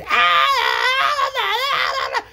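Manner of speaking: high, loud mock baby crying wail. Baby brother screaming in his crib, mock wail.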